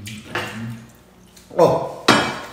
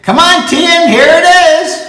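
excited call; 12 semitones higher, 8 dB louder, slower than usual. Real, not acted. Calling to Tien.

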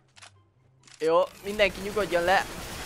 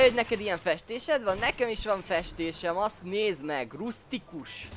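ordinary talk, nothing marked

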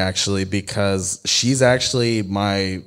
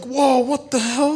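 much higher and slow, baffled whoa; stunned disbelief. Retold or imitated. Retelling to friends how she slammed her book, baffled and stretched. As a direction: react like she just stormed off.

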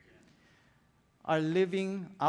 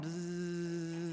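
bee buzz; 7 dB quieter than usual. Pretend. Imitating a bee.

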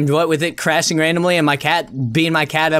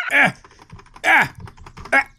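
startled yelps; 9 semitones higher, slower than usual. Real, not acted. Startled by sudden event.

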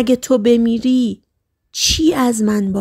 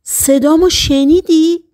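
higher, louder, stunned shout; shouted question; 6 semitones higher, 4 dB louder than usual. A narrator's reading. Son shouting at his dying father in disbelief, raised voice. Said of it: "داد زدم".

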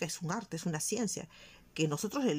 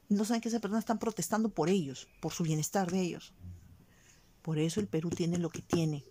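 ordinary talk

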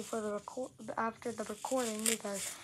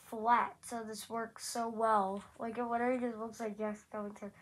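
normal talking voice

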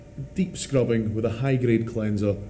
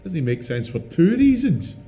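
high-pitched indignant outburst; exasperated rant; 12 semitones higher, 4 dB louder than usual. Acted. Bearded man objecting to the Frodo heckle, exasperated. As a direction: climb to a loud, squeaky protest.

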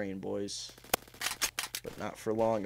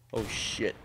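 ordinary talk, nothing marked

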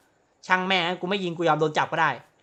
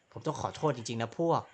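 lightly voiced cop; 6 semitones lower, 6 dB quieter than usual. Gamer voices a police officer, lightly lowered solemn tone. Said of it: "เขามารายงานบอกว่า".

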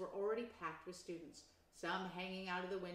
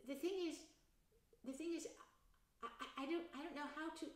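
nervous stammer; 9 semitones higher, 5 dB louder than usual. Acted.

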